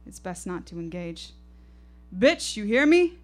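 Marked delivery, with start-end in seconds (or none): 2.2-3.2 high, loud, menacing shouts; aggressive yelling. Acted. Drunk man yells threats at the silent women, high and loud. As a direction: bark the threats to scare them.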